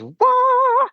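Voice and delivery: high, loud mock scream; mock rock scream. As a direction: belt out a parody metal screamer's wail. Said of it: "the guy that goes".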